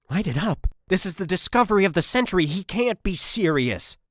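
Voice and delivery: high, loud shocked disbelief; can't believe the order; incredulous. A narrator's reading.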